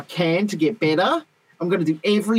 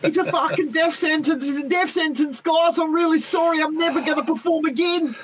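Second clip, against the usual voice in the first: mock panic; 10 semitones higher than usual.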